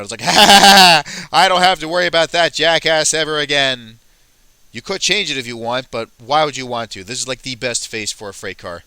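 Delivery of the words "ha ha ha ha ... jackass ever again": loud, higher mock cackle into a gleeful line; happy gloating. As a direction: laugh it up as a relieved freight car, loudly. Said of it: "he seems really happy".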